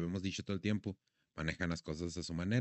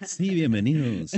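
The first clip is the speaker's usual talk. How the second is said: booming announcer voice, louder; radio announcer voice; 5 semitones higher, 8 dB louder than usual. Shown as a demonstration. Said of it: "quiero hacer una pinche voz de locutor".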